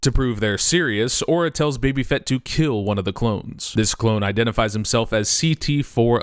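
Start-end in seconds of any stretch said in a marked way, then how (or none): none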